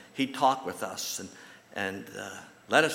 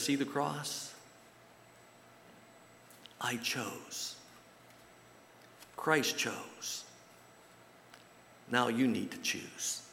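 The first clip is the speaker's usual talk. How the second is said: softer, slow and weighty; solemn quiet; 7 dB quieter, much slower than usual. Retold or imitated. God answers the doubters, softer and solemn. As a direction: let each short line land softly.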